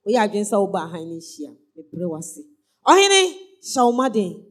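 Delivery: preacher praying aloud for the congregation; fervent prayer. Acted. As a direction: pray aloud leading the church.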